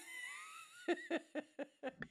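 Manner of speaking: high, squealing laugh; long falling cackle. Real, not acted. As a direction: burst into high-pitched laughter. Big laugh while being teased.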